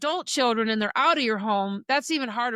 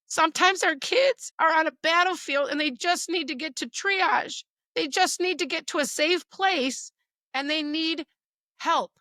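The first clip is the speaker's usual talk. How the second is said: getting emotional; 5 semitones higher, 3 dB louder than usual. Real, not acted. Mother on a podcast, moved about her kids, welling up. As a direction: voice welling up, pitched higher and louder. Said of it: "I'm gonna get emotional".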